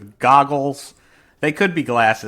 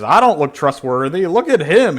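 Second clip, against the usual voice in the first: higher, louder mock indignation; indignant protest; 6 semitones higher, 7 dB louder than usual. Cortex objects to being called untrustworthy, indignant. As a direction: protest as an offended cartoon villain, pitched up.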